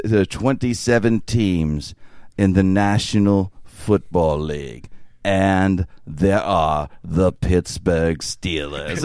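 low, slow, grand announcer read; slow, dramatic sports-film narration; dramatic narrator voice. Retold or imitated. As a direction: drag it out like an epic film narrator. Quoting famous narrator line.

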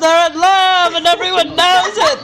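high, loud gushing declaration; mock outburst. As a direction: proclaim it in a hammed-up cry. Mock declaration about the characters.

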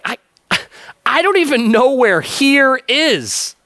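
high, slow, laughing confusion; a man lost at heaven's gate; clueless thief. Retold or imitated. Thief answering the angel guard, high and laughing in confusion. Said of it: "the guy's like".

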